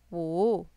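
low dip on wu, then up; falling-rising tone on wu. Shown as a demonstration. Teacher models number five for learners, falling-rising wu. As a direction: dip wu low and let it rise. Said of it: "This is a falling rising tone".

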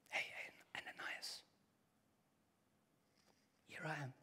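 a hushed whisper from start to end. Shown as a demonstration. Preacher whispers God's call to Ananias to his church. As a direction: whisper it like a secret call in the night. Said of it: "when I whisper it".

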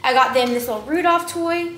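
ordinary talk

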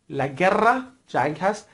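normal talking voice

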